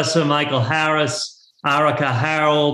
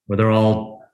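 normal talking voice

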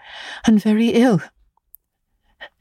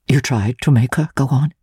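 much lower-pitched, probing question; lightly voiced questioning sister; 8 semitones lower than usual. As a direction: drop the voice low for the sister's question. Sister interrupts the tale to ask.

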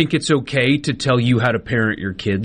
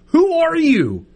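indignant outburst; 12 semitones higher, slower than usual. Real, not acted. Indignant rant about stranger.

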